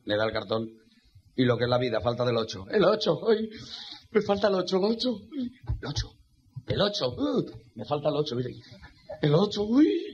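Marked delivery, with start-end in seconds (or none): 2.7-6.1 pitched-up, urgent pleading for the eight; straining higher and higher, begging for one number; frantic gambler. Acted. Bingo player begging for the eight, rising frantic pleading. 6.7-10.1 anxious gambler. Acted. Gambler still waiting for eight.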